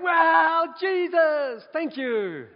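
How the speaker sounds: high, loud cry of amazement and thanks; elated exclamation. Retold or imitated. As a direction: shout it out, then ease down on the thanks. Relives seeing his name at airport.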